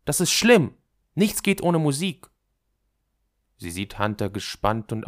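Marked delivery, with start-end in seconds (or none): 0.0-2.3 put-on high, loud girl's voice; agitated girl. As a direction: pour out the grievance, high and insistent. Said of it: "Sie redet so komisch". (aufgeregtes Mädchen, hoch und laut)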